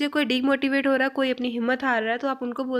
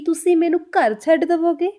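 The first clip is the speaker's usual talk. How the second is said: louder, higher, emphatic Punjabi question; forceful Punjabi; 4 semitones higher, 5 dB louder than usual. Shown as a demonstration. Teacher shows learners Punjabi is spoken with more force, louder. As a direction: put some force into it, speak up. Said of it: "तो थोड़ा ज़ोर से बोली जाती है".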